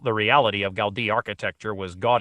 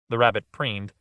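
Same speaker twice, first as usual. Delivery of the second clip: usual speaking voice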